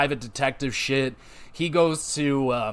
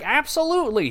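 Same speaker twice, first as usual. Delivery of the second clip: eager yes; 12 semitones higher than usual. Retold or imitated. Madison eagerly agrees.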